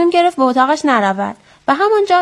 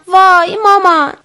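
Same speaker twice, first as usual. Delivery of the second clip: scared cry; 8 semitones higher, 5 dB louder than usual. A narrator's reading. Scared child cries to mom.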